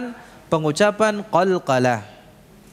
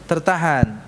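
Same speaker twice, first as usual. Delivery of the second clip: ordinary talk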